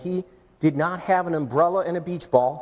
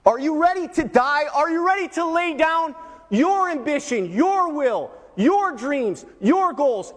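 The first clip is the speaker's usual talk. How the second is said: raised, urgent voice, driving each item home; impassioned preaching challenge; 8 semitones higher than usual. Acted. Preacher challenges listeners to surrender everything, high and impassioned. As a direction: ring it out high and forceful. Preacher repeats the invitation, escalating.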